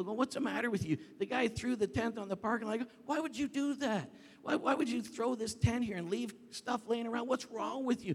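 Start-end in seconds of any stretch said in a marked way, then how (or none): 0.2-1.0 raised, higher-pitched scolding; retold shouting. Pastor shouting at litterers in the parking lot, indignant retold shout. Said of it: "I'm shouting at people". 3.0-8.1 high-pitched indignant scolding; retold shouting. Pastor scolding the guy who threw the tent, retold shouting. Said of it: "I'm shouting at people".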